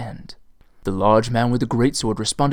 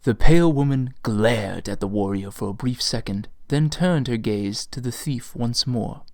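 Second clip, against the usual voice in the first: ordinary delivery, nothing marked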